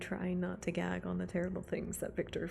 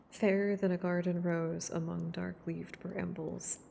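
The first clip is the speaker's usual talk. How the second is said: hushed, close reading of a novel. Acted. Streamer reading Frankenstein aloud to ASMR viewers, hushed and close.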